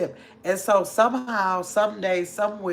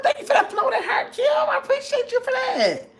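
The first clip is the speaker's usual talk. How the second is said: very high, laughing squeal; amused falsetto thank-you. Real, not acted.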